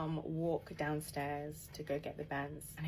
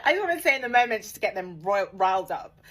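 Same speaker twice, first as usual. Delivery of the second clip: still laughing; 3 semitones higher, 12 dB louder than usual. Real, not acted. Still laughing after fib.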